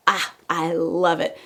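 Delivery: ordinary talk, nothing marked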